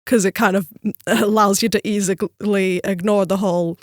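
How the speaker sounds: usual speaking voice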